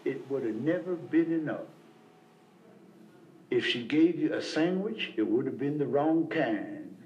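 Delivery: low, slow, deliberate sponsor voice; wise old sponsor. Retold or imitated. Sam answering the angry newcomer, low and unhurried. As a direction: hand down the lesson slowly, in a deep calm tone.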